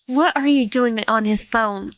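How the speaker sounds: usual speaking voice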